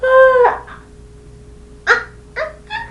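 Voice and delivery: high, loud yelps of alarm; hammed-up high cries of distress; mock panicked yelp. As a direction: squeal like you're stuck to the pillow. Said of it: "it was like".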